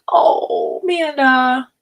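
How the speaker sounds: real tears catching on the name; choked up. Real, not acted. Touched by a viewer's grief, crying out her name.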